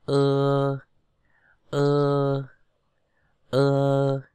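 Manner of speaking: long, level Ơ vowel; E read as Ơ. Shown as a demonstration. Teacher shows learners how E is read, a drawn-out Ơ. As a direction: hold Ơ steadily for the letter E. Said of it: "Chúng ta bắt đầu đọc nào".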